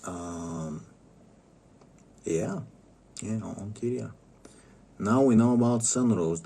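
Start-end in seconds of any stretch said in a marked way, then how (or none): none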